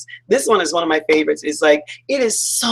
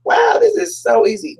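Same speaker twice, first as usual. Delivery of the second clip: very high, delighted marvelling; imitated wonder; 14 semitones higher than usual. Canoeist sitting back in the flow, high-pitched wonder. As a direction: coo in mock amazement at the view. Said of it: "You're gonna be sitting back like this saying".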